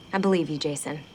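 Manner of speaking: normal talking voice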